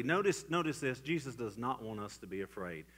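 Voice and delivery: normal talking voice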